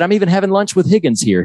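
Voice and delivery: high, quick chirpy Ted; imitating Ted. Host imitating Ted introducing Higgins, high and quick. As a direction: pitch it up eagerly. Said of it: "He said".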